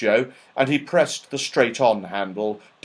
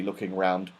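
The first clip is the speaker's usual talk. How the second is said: ordinary delivery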